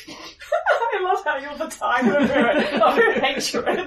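laughing through the words. Real, not acted. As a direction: say it while cracking up. Player laughing at the insult.

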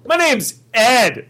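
put-on slow, high, accent-free announcement; plain American accent, exaggeratedly drawn out; English, no accent. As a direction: say it loudly and slowly with no accent. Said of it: "Total English, no accent".

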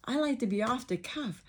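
ordinary delivery

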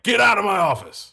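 mock furious coach yelling high and loud; angry shout. Teacher playing coach throws student out: furious shout.

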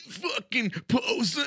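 high, strained imitation of a screamed insult; mock metal scream. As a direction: fake a singer's scream at modest volume. Mocking the singer's screamed insult.

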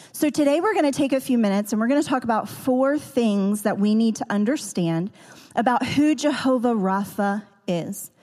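ordinary talk

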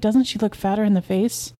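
ordinary delivery, nothing marked

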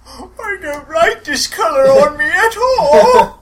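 high, slow, loud lament; hammed-up wailing. Orc bemoans his new blue colour, hammed-up wail.

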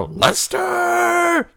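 high, shrill-pitched yell of "Lester"; impatient listeners yelling for the host; mock scream of his name. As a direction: scream the name like a frantic fan. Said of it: "screaming my name".

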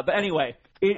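ordinary talk, nothing marked

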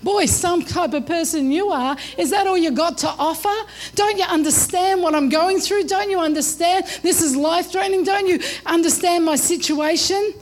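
normal talking voice

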